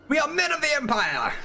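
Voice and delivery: high-pitched Empire battle cry; Empire soldier. Pretend. Streamer as soldier rallying the Empire, high mock-heroic cry. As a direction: proclaim it like a proud trooper.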